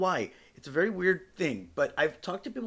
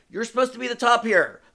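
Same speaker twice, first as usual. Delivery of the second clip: higher, louder comic indignation; raised-voice reprimand of a lover; mock scolding; 8 semitones higher, 7 dB louder than usual. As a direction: call them out, exasperated. Scolding the role-confused top.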